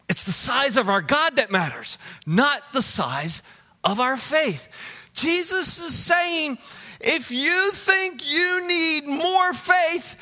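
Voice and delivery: high, emphatic pulpit voice; forceful preaching. Real, not acted. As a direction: preach it with rising intensity, stretching the key words.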